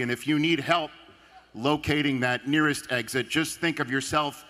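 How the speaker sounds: measured emcee announcement with a deadpan setup; formal announcement. Acted. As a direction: read it like a straight-faced emcee. Speech at the event, played clip.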